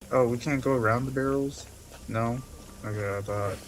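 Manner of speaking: ordinary talk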